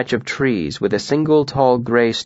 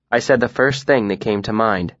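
ordinary delivery, nothing marked

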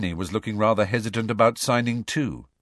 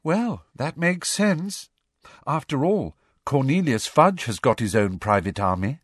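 piping up; 6 semitones higher than usual. A narrator's reading. Odd girl piping up in the meeting, high start. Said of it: "who piped up".

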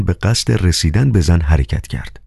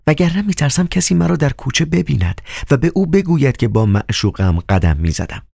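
Nisa's voice; 8 semitones higher than usual. Acted. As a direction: speak as a hushed high coquette. (نیزا با صدای زیر و آهسته زنانه)

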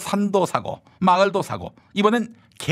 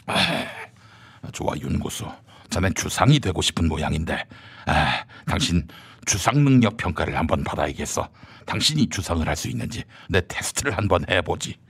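low stern minister. Acted. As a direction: press him like a cold interrogator. Low rival character.

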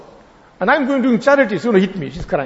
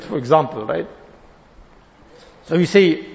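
normal talking voice

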